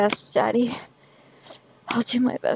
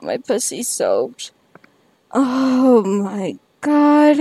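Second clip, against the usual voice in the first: slow, shaky climactic moaning; drawn-out moans; 3 semitones higher, much slower than usual. Real, not acted. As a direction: let it out slowly. After-climax drawn cries.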